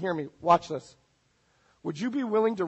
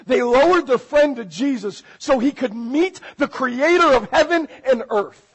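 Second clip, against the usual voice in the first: raised, high sermon climax; preaching at full force; 7 semitones higher, 6 dB louder than usual. Real, not acted. Preacher climaxing the story of four friends, high and forceful. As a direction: lift the voice to land the story.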